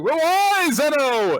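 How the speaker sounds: high, loud pleading question; hammed-up high question; mock plea. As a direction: ask it like a shaken boy. Voicing Yajima asking.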